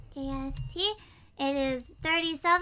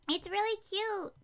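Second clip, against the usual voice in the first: ordinary talk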